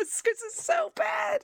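high, slow laughing shout; laughing exasperation. Real, not acted. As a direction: cry out through laughter. Laughing at stilted quote.

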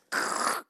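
unvoiced crackly throat scrape; imitated scratching noise. Co-host describing the bad sound, imitated scratching. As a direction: mimic bad-audio scratching with a rough noise.

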